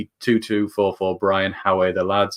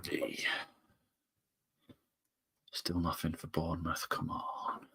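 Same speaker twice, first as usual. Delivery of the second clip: whisper; 3 semitones lower, 18 dB quieter, slower than usual. Real, not acted. Quietly urging his Bournemouth bet.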